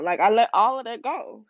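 ordinary talk, nothing marked

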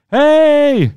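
long, high, loud yelled call; full-voiced acted shout; screaming. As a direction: scream it out to someone in another room. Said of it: "he starts screaming".

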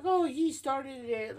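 high, drawn-out mock protest; mock whiny blame. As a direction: imitate a squabbling man's indignant complaint. Retold brawlers blaming each other.

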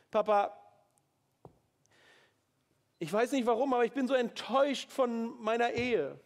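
high, loud, raw plea to Papa; pleading prayer. Pretend. Worshipper pours out stress to God, pleading high and loud. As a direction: pour out your pain like a child crying to its father. Example prayer pouring out feelings.

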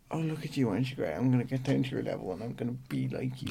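mock-patronising, softly spoken; soft, condescending mimicry. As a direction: quietly talk down to them, imitating a condescending helper. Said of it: "it is quite patronising".

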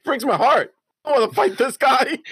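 mock-outraged dad; exasperated dad. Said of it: "I was just like".

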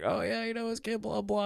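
very high, soft mock voice; high-pitched, hushed blah-blah imitation; imitated chatterer. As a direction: rattle off filler as a know-it-all fan. Mimicking the football expert's chatter.

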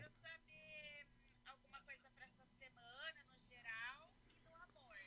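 faint faraway voice, weak connection; words fading out on a bad line; barely audible. Real, not acted. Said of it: "Tá muito baixo".

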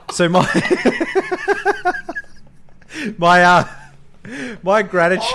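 loud, struggling through laughter; real laughing fit breaking up the words; cracking up. Real, not acted. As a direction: try to get it out while helpless with laughter. Trying to speak while cracking up.

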